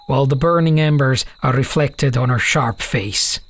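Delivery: plain reading voice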